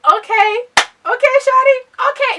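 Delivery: high, loud, giddy okay okay shawty; excited inner squeal. Retold or imitated. Narrator reliving her thrill at his smile, giddy and loud. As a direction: gush like a smitten fan.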